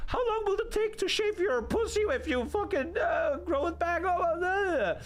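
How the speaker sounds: high-pitched, mocking babble; obnoxious date. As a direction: mimic an annoying date firing off questions. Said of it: "And you're like".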